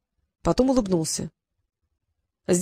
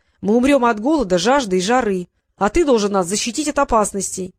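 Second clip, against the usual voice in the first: plain reading voice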